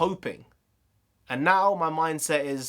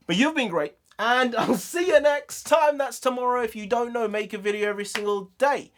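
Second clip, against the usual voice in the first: upbeat outro; 10 semitones higher, 3 dB louder than usual. Acted. High-energy vlog outro.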